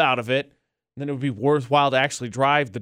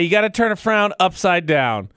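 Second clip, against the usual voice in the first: normal talking voice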